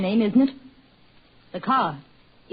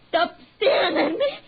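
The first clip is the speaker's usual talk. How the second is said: higher, crying plea; panicked cry; 8 semitones higher, 3 dB louder than usual. Acted. Wife begging husband to stop staring, crying in panic. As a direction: sob it out in panic.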